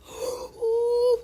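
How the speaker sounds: sharp, high excited gasp; real high gasp of joy. Real, not acted. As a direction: gasp in delight. Gets the whip item.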